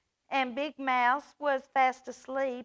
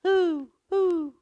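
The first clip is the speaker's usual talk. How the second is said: imitated hooting; 9 semitones higher, 6 dB louder, slower than usual. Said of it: "It keeps calling out".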